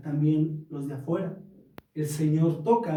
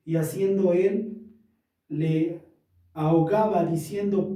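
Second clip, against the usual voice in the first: louder, steady reading of the Bible passage; scripture reading; 4 dB louder than usual. Acted. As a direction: proclaim the verses to the congregation. Pastor reads Bible passage aloud.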